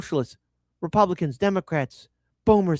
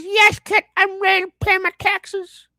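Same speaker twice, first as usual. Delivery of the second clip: high squeaky put-on voice, much higher; high mock voice of an obedient taxpayer; mock eager taxpayer; 13 semitones higher, 5 dB louder than usual. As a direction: play a brainwashed eager citizen.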